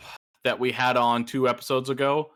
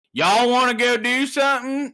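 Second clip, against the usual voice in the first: Texan accent; 12 semitones higher, 5 dB louder than usual. Shown as a demonstration. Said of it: "Texas, it's like".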